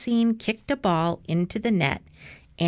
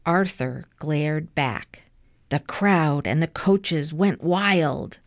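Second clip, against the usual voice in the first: ordinary delivery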